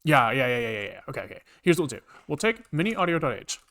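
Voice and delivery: ordinary talk, nothing marked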